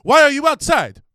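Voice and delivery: high, furious scolding; angry yelling. Retold or imitated. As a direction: yell at the public like a furious mayor. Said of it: "just yelling in Italian".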